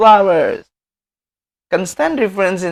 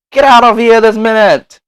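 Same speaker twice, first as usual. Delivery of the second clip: angry order; 6 semitones higher, 8 dB louder than usual. Acted. Example of an angry command.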